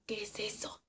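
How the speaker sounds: hushed, barely voiced query; whispered question. Worker asking coworker about the code, whispered. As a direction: whisper it so nobody else hears.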